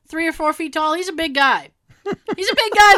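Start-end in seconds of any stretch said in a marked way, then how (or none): 2.0-3.0 higher, louder "big guy"; emphatic repeat. Shown as a demonstration. Telling the host about the Grinch, higher and louder. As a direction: hammer home how huge he is. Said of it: "repeats the phrase for effect".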